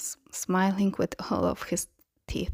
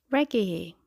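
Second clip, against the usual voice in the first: mild booming call, raised a little. A narrator's reading. Brother Sirius greeting Regulus, booming a little. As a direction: call out a name, understated boom. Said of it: "his brother's booming voice".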